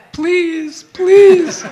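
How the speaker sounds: high, loud begging please; eager pleading. Retold or imitated. Singer pleading to manager for Rick's return, high eager begging. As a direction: beg eagerly, voice shooting up. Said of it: "And I said".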